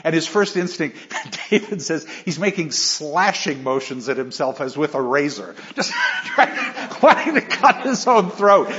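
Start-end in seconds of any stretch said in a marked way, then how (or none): 5.7-8.7 laughing. Real, not acted. Laughing at Micawber's despair.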